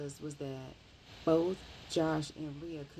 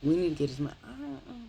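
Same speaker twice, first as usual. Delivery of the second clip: fast, louder urgent insistence; insistent; 10 dB louder, faster than usual. Retold or imitated. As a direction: rush it out, worked up. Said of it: "So she was like".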